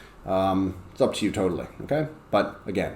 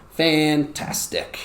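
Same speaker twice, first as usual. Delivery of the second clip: "Fantastic" higher, in three slow beats; drawn-out syllables; 5 semitones higher, much slower than usual. Shown as a demonstration. Teacher shows how to say "Fantastic", slow and split up. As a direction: chant out each syllable of "Fantastic". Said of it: "So I go".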